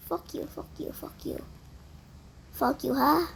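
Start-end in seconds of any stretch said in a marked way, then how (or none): 0.1-1.4 unvoiced whispered cursing; whispered curse. Real, not acted. Player curses Granny under his breath in a whisper.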